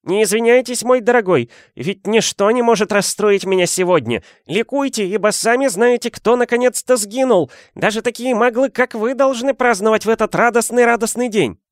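put-on very high squeaky little old man. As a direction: squeak it out with giddy delight. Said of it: "заговорил таким писклявым голоском".

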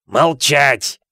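explosive barked command; Uncle Vernon bellowing. A narrator's reading. Uncle cutting off nephew, sudden bellow. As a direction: silence the boy with a shout. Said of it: "завопил дядя Вернон".

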